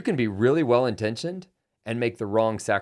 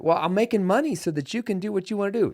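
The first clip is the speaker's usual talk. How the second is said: mock defensive husband; 10 semitones higher than usual. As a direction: play the excuse-making spouse, pitched up. Acted husband-wife quarrel.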